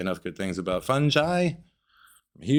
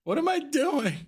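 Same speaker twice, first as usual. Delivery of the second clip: much higher, bewildered question; high mock-lost wail; mock confusion; slower than usual. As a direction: squeak out the doubt. Acting the confused cook.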